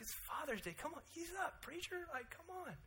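high, whiny plea to ease up; mock complaining listener. Congregant begs the preacher to ease up, mock whiny. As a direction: whine like a congregant begging the preacher. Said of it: "You're like".

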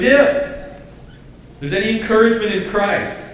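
high, loud preaching voice; preacher's call. Retold or imitated. Paul calling on the church, loud high preaching. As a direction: fling it out like a preacher rousing the crowd. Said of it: "He goes".

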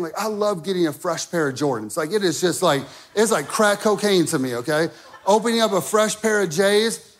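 ordinary talk, nothing marked

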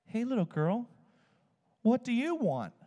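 put-on high, gentle voice bending down to a child; kindly asking a child. Heidi Baker asking a little girl, high and gentle. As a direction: lean in and ask a little girl sweetly. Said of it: "Heidi Baker leans down and says".

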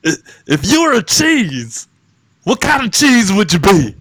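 high warbling Will Ferrell announcer voice; Harry Carey. Retold or imitated. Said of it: "read it in the same voice".

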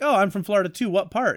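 mock surprise. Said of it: "I'm like".

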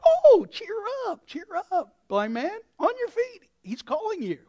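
high, gushing cheer-up; mock-friendly crowd turning sweet, pitch leaping; sudden encouragement. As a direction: coax him along in a high voice. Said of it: "the peer pressure changes all of a sudden".

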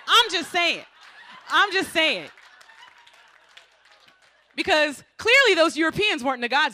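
cheeky loud aside. Real, not acted. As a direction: belt it out like a guilty brag. Cheeky confession about taking the house.

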